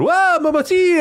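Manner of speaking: high, loud mocking catcall; retold catcall. Cab driver catcalling a girl on the sidewalk, high hammed-up yell. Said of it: "yelling to her".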